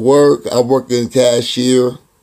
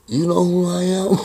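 higher-pitched imitation of an annoyed man; mock-irritated demand, pitched up; irritated challenge; 7 semitones higher than usual. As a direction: retell it as a pointed, high question. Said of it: "He'll give me this look".

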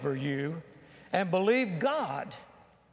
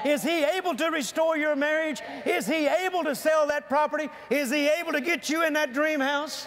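high, loud rousing preacher calls; preaching at full force; 8 semitones higher, 3 dB louder than usual. Acted. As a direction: build the congregation up with each question. Crescendo of preaching questions.

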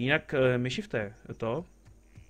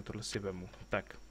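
normal talking voice

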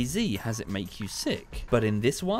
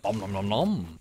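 nom nom chomping; 3 semitones lower than usual. Shown as a demonstration. Dinosaur eating berries.

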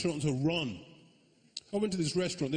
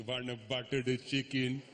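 slow, careful Indian accent; change your voice into an Indian accent; drawn-out mock-Indian ordering; 2 semitones lower, slower than usual. Shown as a demonstration. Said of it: "To change your voice".

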